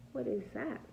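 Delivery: ordinary delivery, nothing marked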